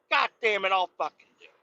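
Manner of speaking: high exasperated give-in; grudging curse. Retold or imitated. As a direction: cave in with a grumbling curse. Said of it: "I'm like".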